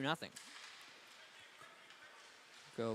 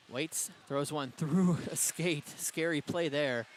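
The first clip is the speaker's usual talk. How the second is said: startled call; 3 semitones higher, 6 dB louder, slower than usual. Real, not acted. Startling play near a skate.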